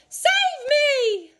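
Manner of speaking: very high, loud scream; screaming for help. A narrator's reading. Missus Twit flying off, screaming high and loud. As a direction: shriek it as she shoots skyward. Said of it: "she screamed".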